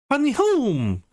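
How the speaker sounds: high, drawn-out happy cry; excited greeting. Acted. Girl greeting Jack as he gets home, excited.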